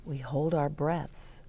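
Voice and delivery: ordinary delivery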